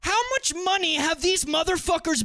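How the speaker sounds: loud, high outburst; frustrated yelling. Real, not acted. Ranting at chatter R-Wing's logic, high exasperated yell. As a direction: let the anger explode. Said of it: "I'm not yelling at you".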